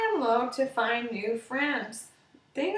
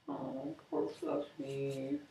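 pitying aside; 2 semitones higher, 11 dB quieter, slower than usual. A narrator's reading. Narrator pities the sad bunny.

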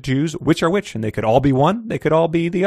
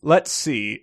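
ordinary talk, nothing marked

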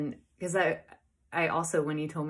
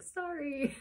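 high little mock apology; imitated playful apology; 12 semitones higher than usual. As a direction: squeak out a girlfriend's apology. Girlfriend lets his secret slip.

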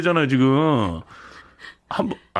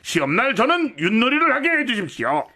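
high, eager student; 10 semitones higher than usual. Acted. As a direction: play a cheeky eager student. Plays student in comedy skit.

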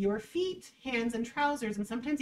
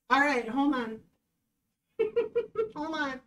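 normal talking voice

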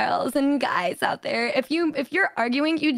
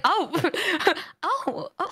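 shocked oh; 5 semitones higher, 4 dB quieter than usual. Retold or imitated. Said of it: "I was just shocked".

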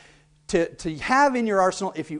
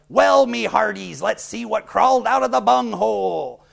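pirate voice; 4 semitones higher, 3 dB louder than usual. Shown as a demonstration. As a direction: boom it like a ship's captain. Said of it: "You might say something like".

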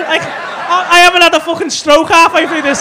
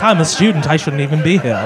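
lower, timid mock student voice; mock nervous student; 9 semitones lower than usual. Mocking a family member as a nervous student who wants to leave, lower and timid. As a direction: play the scared out-of-place student, lightly. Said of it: "He's like".

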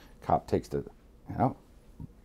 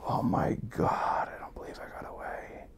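whispered relief; 8 dB quieter than usual. Retold or imitated. Retold relief after cop leaves.

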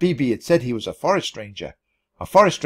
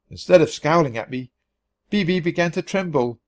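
usual speaking voice

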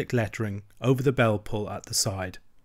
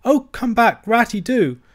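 much higher, louder tearful plea; pleading cry; 11 semitones higher, 7 dB louder than usual. A narrator's reading. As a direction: beg him to come back. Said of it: "cried the Mole".

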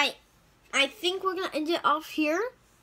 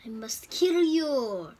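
slow, much louder mock villain voice to the cow; silly menacing voice; 10 dB louder, slower than usual. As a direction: drag it out like a cartoon villain threatening a cow. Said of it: "Why am I talking like that?".

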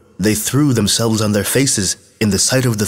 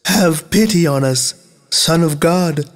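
tearful outcry; 9 semitones higher than usual. A narrator's reading. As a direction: plead as one, pitched well above the narration. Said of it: "cried out and wept greatly".

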